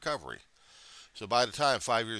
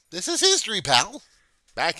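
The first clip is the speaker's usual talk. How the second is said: much higher and louder, jabbing the point home; emphatic outburst; 13 semitones higher, 12 dB louder than usual. Real, not acted. Telling viewers that this is history, with a loud, high outburst. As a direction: hit them with it, loud and high.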